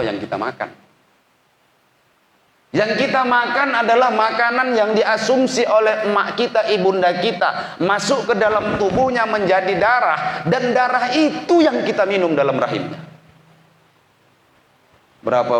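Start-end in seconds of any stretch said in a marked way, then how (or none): 2.7-13.0 high, loud fervent sermon voice; a preacher pleading with young students; impassioned preaching. Acted. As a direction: preach it out with full emotional force. Preacher rises to full force.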